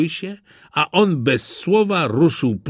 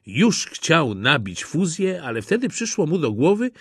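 usual speaking voice

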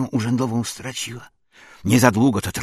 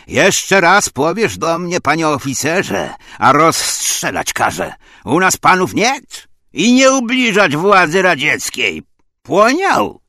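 loud, raised angry threat; angry outburst, building higher; enraged commander; 6 semitones higher, 7 dB louder than usual. Acted. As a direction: lay down the law in a furious officer's shout. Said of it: "komandir rozsierdził się na mnie".

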